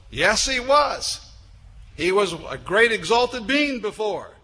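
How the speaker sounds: loud, high, ringing sermon delivery; full-force preaching. Real, not acted. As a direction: preach it out, building to an exalted climax.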